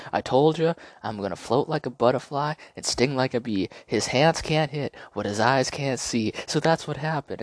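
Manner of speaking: hammed-up Ali swagger; Ali poem. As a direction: rhyme it like the cocky champ. Ali reciting float like a butterfly.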